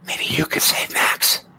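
put-on slow, tearful whisper of a small child; whispered child plea. Child looks straight at Reggie, whispered hopeful plea. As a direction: plead in a tiny whispered voice.

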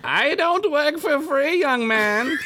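high, slow cranky elder voice; old wise man voice. Pretend. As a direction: play a fussy old sage. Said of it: "get Reese's the Wise".

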